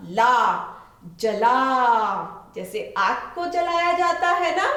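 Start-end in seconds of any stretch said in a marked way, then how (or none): 1.2-2.5 जला stretched slowly, long आ on ला; drawn-out word. Shown as a demonstration. Teacher to children, slowly stretching जला. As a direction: draw जला out for young readers. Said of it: "ल पर लगी आ की मात्रा".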